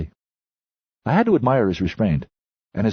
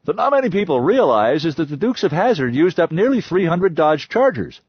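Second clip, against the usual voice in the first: loud, higher lecturing voice of the history buff; loud know-it-all; 9 semitones higher, 4 dB louder than usual. A narrator's reading. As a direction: hold forth like a pompous expert. The loudest voice was holding forth.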